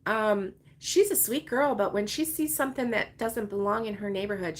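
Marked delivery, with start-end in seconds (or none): none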